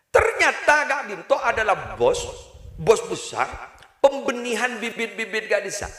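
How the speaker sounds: high, animated storytelling voice; lively voiced kiai. Preacher voicing the rich boss to the congregation, high and animated. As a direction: act out the boss telling his tale.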